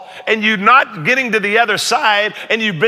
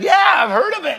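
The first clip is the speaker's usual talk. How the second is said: high, sly playful reply; coy mock innocence; 11 semitones higher than usual. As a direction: tease them, pretending modesty. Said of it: "I said".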